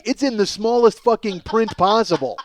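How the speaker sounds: ordinary talk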